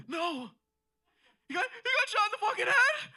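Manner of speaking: very high, loud hysterical dad impression; mock panicked scream. Dad in underwear learns of the shooting, mock panicked scream. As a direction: scream it in disbelief, hamming it up.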